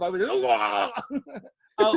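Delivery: ordinary delivery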